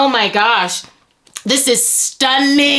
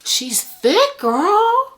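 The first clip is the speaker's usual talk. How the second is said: normal talking voice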